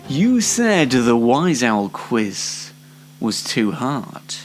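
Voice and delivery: normal talking voice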